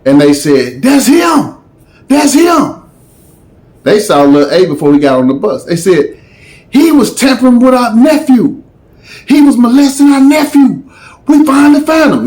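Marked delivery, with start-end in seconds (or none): 0.8-2.8 much higher identifying cry; mimicked girls. Girls spotting Lil A at the bus, higher mimicked cry. 6.7-12.3 retold outcry. Retold angry accusing girls.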